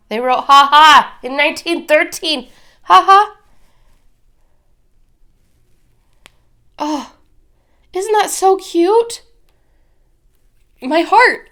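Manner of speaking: high, loud, delighted squeals; gushing over cute. Real, not acted. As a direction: melt over how cute it is. Gushing over a 1913 postcard.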